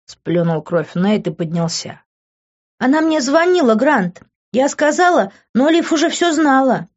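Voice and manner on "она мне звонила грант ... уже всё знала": put-on pitched well up into a young woman's voice; a young woman's voice, lifted high; girlfriend's voice. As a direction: play the worried girlfriend explaining, voice raised high. Demi voiced by male narrator.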